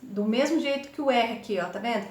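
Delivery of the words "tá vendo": ordinary delivery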